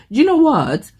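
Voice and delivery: high, loud English accent imitating a YouTuber; English accent impression. Quoting the YouTuber she watches, in an English accent. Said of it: "And she always says".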